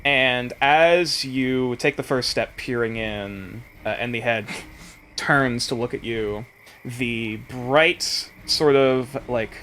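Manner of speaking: game master scene setting. Acted.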